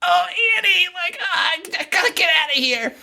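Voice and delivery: very high, frantic imitation of a screamer; toned-down mock scream. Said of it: "She's screaming at the top".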